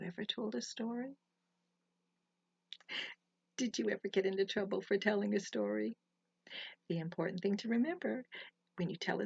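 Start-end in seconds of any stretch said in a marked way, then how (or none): none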